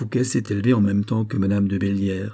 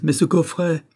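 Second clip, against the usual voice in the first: ordinary delivery, nothing marked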